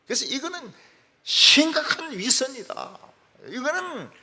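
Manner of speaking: high, loud indignant accusation; an outraged fiancée denouncing him; accusing bride. Retold or imitated. Bride accusing her fiancé, high indignant outburst. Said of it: "여자가 남자를 의심하게 되었어요".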